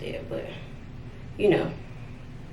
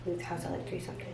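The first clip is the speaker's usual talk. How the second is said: much softer muttered repeat; 2 semitones lower, 15 dB quieter, much faster than usual. Real, not acted. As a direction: say it again under your breath. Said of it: "repeats it softly".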